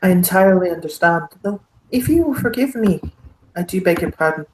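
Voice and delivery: courtly, curious Irish baron; thickest Irish accent. Acted. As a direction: probe politely in a broad Irish accent. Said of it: "the thickest Irish accent".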